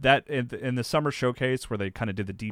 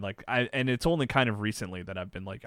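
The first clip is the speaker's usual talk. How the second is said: ordinary talk, nothing marked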